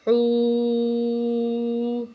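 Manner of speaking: حو stretched long for four beats; four-count madd. Shown as a demonstration. Teacher shows students the long madd, a slow held vowel. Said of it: "چار second ہم جو ہے مد کو کھینچ کے پڑھیں گے".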